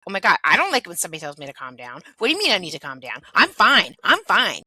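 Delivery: quick, loud, exasperated protest; defensive irritation. Acted. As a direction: fire back hotly, building to a loud insistence. Acts out someone told to calm down.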